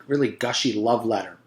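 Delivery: usual speaking voice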